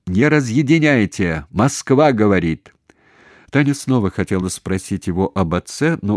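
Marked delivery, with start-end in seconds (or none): 0.1-2.7 loud, cross phone shout; angry shout. A narrator's reading. Caller warns operators off the Moscow line, angry shout. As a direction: shout sternly into the receiver. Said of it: "сердито крикнул".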